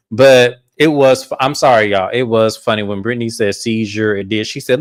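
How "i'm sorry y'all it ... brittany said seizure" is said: ordinary talk, nothing marked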